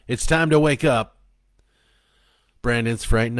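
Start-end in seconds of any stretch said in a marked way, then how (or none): 0.1-1.2 high, loud wake-up call; shout across the room. A narrator's reading. Joe calling across the room to a dreaming boy, shouting. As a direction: shout it to rouse a sleeper. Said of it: "he shouted across the room".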